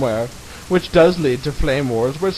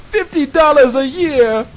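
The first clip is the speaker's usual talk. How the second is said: mock outraged; 9 semitones higher, 5 dB louder than usual. Mocking the price.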